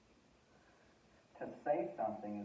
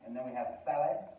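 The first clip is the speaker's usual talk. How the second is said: ordinary talk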